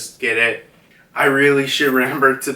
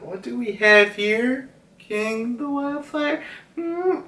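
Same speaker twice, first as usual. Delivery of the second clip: much higher, soft mocking question; teasing Marco; 10 semitones higher, 3 dB quieter than usual. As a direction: lightly tease the foe. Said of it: "Marco's like".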